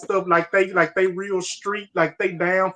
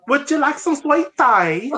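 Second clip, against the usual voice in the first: high, hammed-up British politeness; mock British accent; 6 semitones higher, 4 dB louder than usual. Joking with friends as a British host, high fake British accent. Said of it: "sounding like Stewie Griffin".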